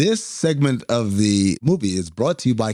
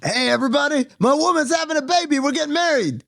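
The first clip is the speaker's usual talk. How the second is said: high-pitched mock proclamation to a crowd; excited retold announcement; public announcement; 12 semitones higher than usual. Retold announcement to a subway car.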